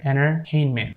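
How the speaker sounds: "entertainment" said as "ennertainment"; silent T. Shown as a demonstration. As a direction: drop the first T in "entertainment". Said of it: "we're not pronouncing this T here".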